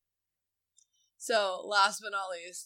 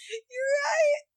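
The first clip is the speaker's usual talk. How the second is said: high, laughing forced encouragement; suppressed laughter; 13 semitones higher than usual. Retold or imitated. Mom praising her son's truck while fighting laughter, high pitched. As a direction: praise him while trying not to crack up. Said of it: "I'm just like".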